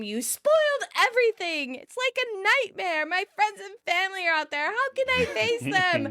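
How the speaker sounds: high, upset wife yelling at her husband; mock yelling. Bride in the bathroom yelling at Ross, high and upset. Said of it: "You can hear Emily from yelling inside the bathroom".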